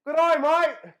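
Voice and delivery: loud, high hammed-up Aussie greeting; a mock Australian drifting to a country twang; mock Australian accent. A guest introducing himself in a hammed-up Australian accent. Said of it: "I just went from Australia to country".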